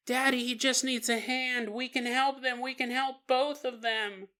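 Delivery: high, soft pleading; lightly voiced tearful little girl; mock sobbing child. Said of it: "She's sobbing because it's terrifying".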